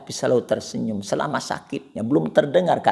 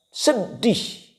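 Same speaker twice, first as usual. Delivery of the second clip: 1 high cry of sorrow; 14 semitones higher, 4 dB louder than usual. Real, not acted. Preacher exclaims sad.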